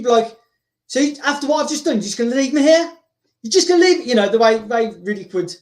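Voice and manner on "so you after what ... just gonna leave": 音调抬高的恼火质问; 像被人甩下一样不服气地质问; 愤愤不平. Acted. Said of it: "this would piss off Riddick".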